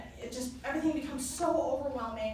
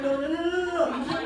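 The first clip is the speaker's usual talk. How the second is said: louder panicked no no no; frantic protest; 3 semitones higher, 6 dB louder than usual. Retold or imitated. To kneeling fiance, loud laughing panicked protest. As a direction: push back in a rush, half laughing.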